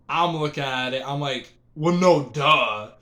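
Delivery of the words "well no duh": loud, emphatic duh dropping at the end; sarcastic obviousness. Retold or imitated. Teller reacting to a silly question, emphatic sarcasm. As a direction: state the obvious with a louder punch. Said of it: "In my mind, I'm like".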